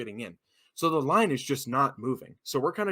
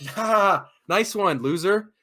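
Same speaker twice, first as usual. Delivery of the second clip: louder, higher mock laugh and jeer; acted sarcastic comeback; 6 semitones higher, 7 dB louder, much slower than usual. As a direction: throw a scornful retort at a kid.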